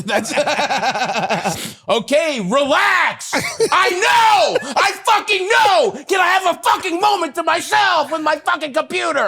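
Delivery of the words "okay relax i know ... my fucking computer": exasperated high-pitched shouting; yelling at kid. Acted. Dad interrupted at his computer by his kid, acted furious yelling. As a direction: blow up in a mock parental rant, voice way up. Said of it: "That's you yelling at your kid".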